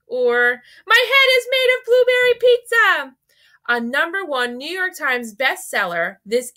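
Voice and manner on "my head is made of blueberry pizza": squeaky high, loud and silly; hammed-up high goofy voice. Shown as a demonstration. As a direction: play it like a kid's nonsense line. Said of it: "goofy sounds like".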